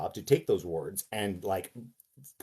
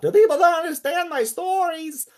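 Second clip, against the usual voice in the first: very high, slow, reedy holler; piping Lovecraft shout; 9 dB louder than usual. Acted. Actor showing how Lovecraft would have actually talked, high piping shout. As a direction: draw it out in a loud, strained piping screech. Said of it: "not presumably how he would have actually talked".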